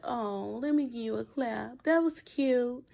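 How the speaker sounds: a fake sweet pat on the head; petty sarcasm. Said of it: "She gave him a petty clap".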